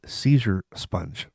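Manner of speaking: Seizure sponge said slow and soft; wife hears the garbled text, slow and soft; slow garbled phrase. Retold or imitated. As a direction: read the typo out slowly and quietly. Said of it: "And I said".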